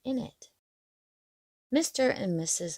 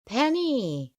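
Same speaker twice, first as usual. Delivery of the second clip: a raised call across the yard; 6 semitones higher, 4 dB louder than usual. A narrator's reading. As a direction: call her name from the doorway. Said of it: "Jason suddenly called from the front door".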